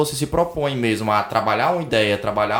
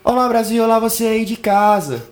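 high, loud host greeting; show-opening announcer; 9 semitones higher, 5 dB louder than usual. Real, not acted. Host greeting Brazil to open the show, big announcer voice. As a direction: hail the whole audience at home.

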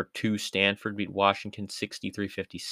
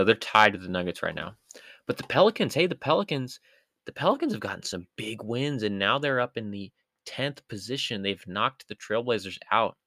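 usual speaking voice

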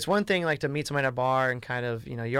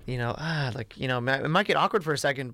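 normal talking voice

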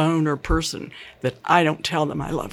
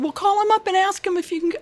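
husband's reply; 14 semitones higher, faster than usual. Retold or imitated. Husband George's quoted reply.

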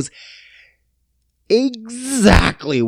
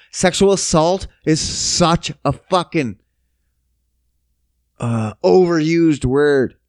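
ordinary talk

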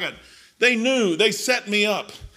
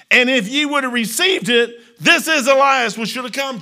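preached scripture; 6 semitones higher, 8 dB louder than usual. Acted. Preacher reading Matthew to the church, loud. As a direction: thunder the verses out.

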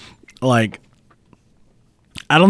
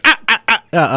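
imitating a high clucking laugh; mock cackle; 10 semitones higher than usual. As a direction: mimic her choppy hen-like laugh. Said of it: "Sarah laughed, and she's like".

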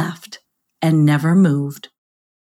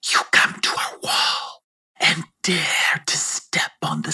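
whispered menacing serpent voice; hissing snake. Acted. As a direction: hiss every threat like a snake. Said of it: "Elfie hissed".

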